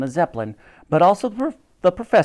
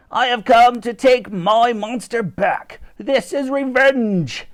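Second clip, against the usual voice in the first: higher, slow villain's vow; a mad professor swearing revenge, slow and high; vengeful professor; 9 semitones higher, 5 dB louder, slower than usual. A narrator's reading. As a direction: gloat it like a storybook villain.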